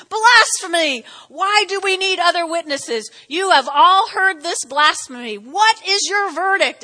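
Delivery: high, loud cry of outrage; outraged high priest. Acted. High priest accusing Jesus before the council, outraged shout. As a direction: hurl the charge at the court. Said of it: "tore his clothes to show his horror".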